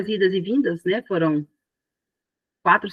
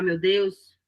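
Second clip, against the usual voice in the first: ordinary talk, nothing marked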